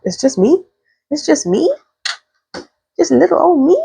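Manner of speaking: high, small, unsure voice; tiny high-pitched "little old me" voice; timid newcomer. Acted. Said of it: "That's how she looks".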